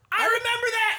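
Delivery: high excited yelp of recognition; excited shout. Retold or imitated. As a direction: blurt it out thrilled and laughing. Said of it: "And I was like".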